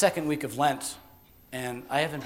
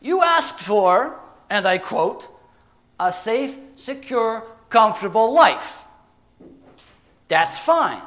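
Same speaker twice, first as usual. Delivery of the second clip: emphatic genie quoting a wish back, higher; explaining genie; 6 semitones higher, 8 dB louder, slower than usual. Acted. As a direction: quote it back pointedly as the genie. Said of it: "he said".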